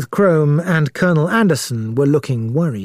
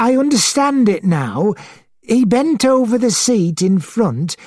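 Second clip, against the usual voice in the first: lightly voiced witness, higher than narration; a hint of an unsettled witness, higher pitched; witness recounting; 9 semitones higher than usual. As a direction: piece together what was seen, in a higher voice. Witness tells what was seen.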